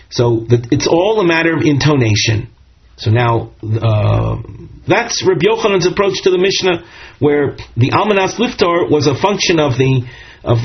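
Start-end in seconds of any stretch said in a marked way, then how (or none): none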